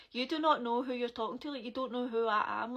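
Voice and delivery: a loud half-acted retelling of telling him off; indignant retold shout.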